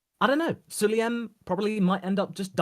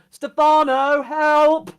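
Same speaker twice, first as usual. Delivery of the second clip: high, loud mock cry for help; mock panicked yell; 11 semitones higher, 11 dB louder, much slower than usual. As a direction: yell for the boss like a panicking team. Panicked teams call for help.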